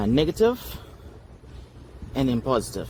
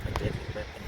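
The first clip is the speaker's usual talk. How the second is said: whispering; 10 semitones lower, 10 dB quieter, much faster than usual. Pretend. Repeats in sinister whisper.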